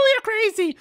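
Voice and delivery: very high, excited bird chatter; jovial kookaburra. Acted. Cookie answers his friend in a squawking bird voice.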